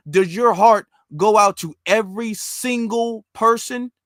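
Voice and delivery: normal talking voice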